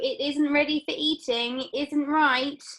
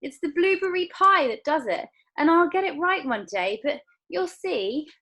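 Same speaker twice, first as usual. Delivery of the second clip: sighed; 6 semitones higher, 6 dB louder than usual. Acted. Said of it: "sighed Mister Wonka".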